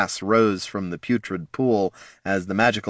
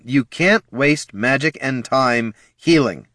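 slow, higher, forceful delivery; insistent; 5 semitones higher, 4 dB louder, slower than usual. A narrator's reading. Bard overruling the wizard, slow and insistent. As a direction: cut in and insist, word by word.

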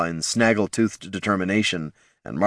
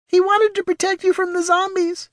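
put-on very high, small child voice; little pup voice. A narrator's reading. Pup Terry confessing to mother, trembling small voice. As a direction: squeak it out like a scared pup. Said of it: "tiny jowls quivering".

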